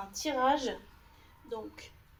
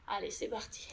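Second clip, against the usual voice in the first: whispered; 2 semitones lower, 5 dB quieter than usual. Real, not acted. Coach exercising alongside the class.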